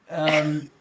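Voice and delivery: ordinary talk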